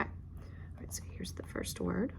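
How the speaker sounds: whispered aside to the student. Real, not acted. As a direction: whisper so as not to disturb. Teacher whispers during student work.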